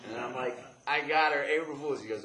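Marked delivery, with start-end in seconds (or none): 0.9-2.0 high, loud prank confession; gleeful boast. Retold or imitated. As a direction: announce it proudly, voice raised. Bragging about prank to father.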